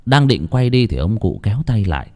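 usual speaking voice

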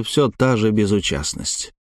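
ordinary delivery, nothing marked

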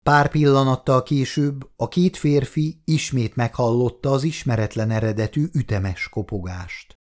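usual speaking voice

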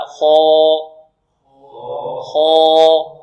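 خا with thick, full alif after kha; heavy kha. Shown as a demonstration. Teacher showing heavy alif after kha. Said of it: "نقول هكذا".

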